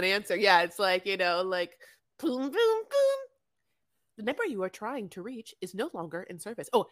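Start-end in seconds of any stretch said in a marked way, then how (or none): 2.2-3.2 slow, high sing-song booms; mock phone error tone. Showing co-host the old phone signal, mimicked tone. 4.2-6.7 phone recording. Retold or imitated. Imitating phone company recording.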